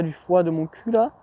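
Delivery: usual speaking voice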